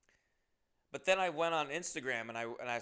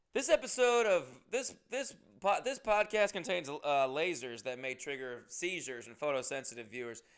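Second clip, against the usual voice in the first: announcer disclaimer; 2 semitones higher, 6 dB louder than usual. Acted. Host reading a mock seizure warning, announcer voice.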